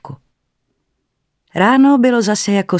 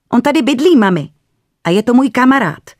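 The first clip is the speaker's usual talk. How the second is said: put-on high, louder little boy boasting; proud child; 8 semitones higher, 6 dB louder than usual. A narrator's reading. Boy proudly telling mother about his friend, high and loud. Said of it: "prozradil s hrdostí Dominik".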